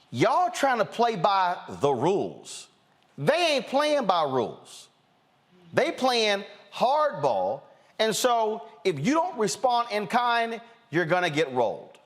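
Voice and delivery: high, emphatic warning; impassioned exhortation. Real, not acted. Host warning Democrats to play hardball, high and insistent. Urging Democrats to fight back.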